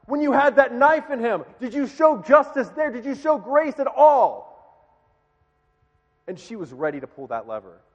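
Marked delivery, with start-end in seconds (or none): 0.1-4.5 loud, high-pitched angry shout as a grieving mother; angry calling out. Retold or imitated. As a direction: hurl accusing questions across at the killer. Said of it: "she calls out back to him".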